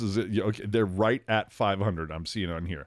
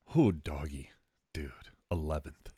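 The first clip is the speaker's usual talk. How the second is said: hushed, breath-only amazement; awed whisper; 5 semitones lower, 5 dB quieter than usual. Real, not acted. Marvelling to co-host at the eleventh pick, awed whisper. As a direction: whisper it low, as if stunned.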